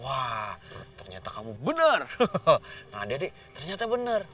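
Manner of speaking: usual speaking voice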